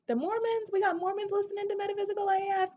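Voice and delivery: high, fast squeal of disbelief; incredulous delight. Real, not acted. Amazed at who is listening.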